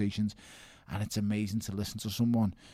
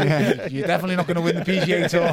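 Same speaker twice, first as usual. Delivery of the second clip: high, loud, laughing banter; 7 semitones higher, 10 dB louder than usual. Real, not acted. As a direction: join the joke through loud laughter. Joking along about critics' excuses.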